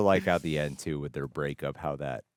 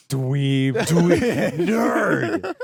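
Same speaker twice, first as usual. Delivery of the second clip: high, loud mock playground taunts; loud, laughing name-calling; playful jeering; 13 semitones higher, 10 dB louder, much slower than usual. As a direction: hurl playful insults at a pal, laughing. Mock name-calling to tease co-host.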